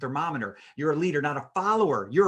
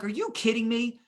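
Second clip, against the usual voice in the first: mock outrage; 8 semitones higher, 4 dB louder than usual. His reply to an upset customer.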